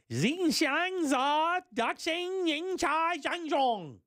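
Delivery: high, very slow Mandarin attempt; mock Chinese. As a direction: drag the syllables out high, trying hard. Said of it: "I could do business in Chinese".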